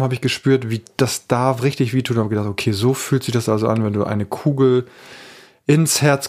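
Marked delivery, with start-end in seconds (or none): none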